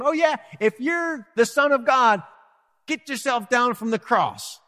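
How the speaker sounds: a lightly voiced jeering crowd, high and sneering; mild mock of a scoffer daring him down; a hint of a taunting onlooker. Crowd taunting Jesus on cross.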